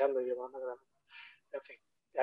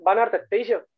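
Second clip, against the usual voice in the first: ArtStation pushed up and louder, insisting; emphatic repeat; 5 semitones higher, 5 dB louder than usual. Real, not acted. Mentor insisting to students where recruiters look, emphatic. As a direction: drive the point home on "Van a ArtStation". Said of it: "De cabeza".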